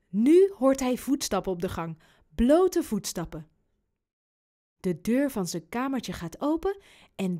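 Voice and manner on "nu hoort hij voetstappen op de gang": ordinary delivery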